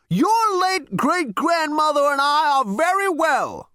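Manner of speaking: put-on high, loud elderly rasp; rasping old man. A narrator's reading. Great-grandfather rebukes Zaphod, high loud rasp. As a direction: rasp it indignantly like an ancient. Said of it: "rasped Zaphod Beeblebrox the fourth".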